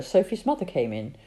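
plain reading voice

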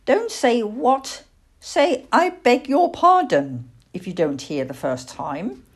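lightly voiced elderly schoolmistress tone; prim old lady, scolding. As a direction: correct a child's manners.

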